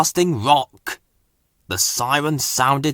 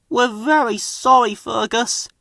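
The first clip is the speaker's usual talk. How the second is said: higher, contrite engine voice; sorry apology; 10 semitones higher than usual. A narrator's reading. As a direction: apologise sheepishly. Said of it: "added Ben".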